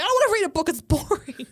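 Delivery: high, loud mock kid complaint; protesting child. Daughter refuses her mum's order, high loud childish protest. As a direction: imitate a small child objecting to a chore. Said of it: "And she'll be like".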